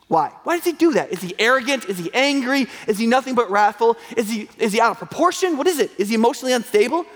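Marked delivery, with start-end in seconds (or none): none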